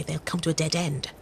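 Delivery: normal talking voice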